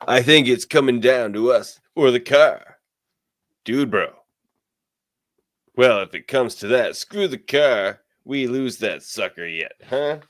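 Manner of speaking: high, loud surfer bro; surfer dude voice. Acted. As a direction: play a laid-back surfer dude. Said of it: "I do it in the Surfer voice".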